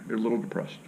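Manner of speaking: whispered softly under the breath; whispered aside. Retold or imitated. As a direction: whisper it like a secret diagnosis. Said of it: "What is this, Doc?".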